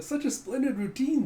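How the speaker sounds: high, simpering praise; butter someone up in a high voice; fawning flatterer. Retold or imitated. Buttkiss flatters Zeka.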